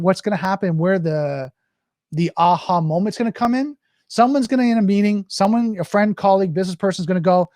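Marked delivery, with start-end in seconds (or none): none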